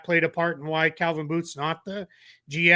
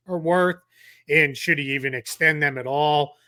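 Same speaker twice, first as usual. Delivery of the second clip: ordinary talk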